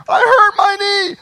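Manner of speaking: put-on high, loud little hurt kid; child voice. Preacher voicing a hurt child to the congregation, high and loud. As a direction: squeak it out like a child with a sore knee.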